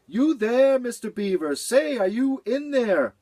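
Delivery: high, loud cat voice calling. Acted. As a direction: call out into the house. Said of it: "called out".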